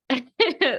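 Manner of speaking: high laugh close to tears; choked-up giggle; teary laugh. Real, not acted. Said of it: "that's gonna make me cry".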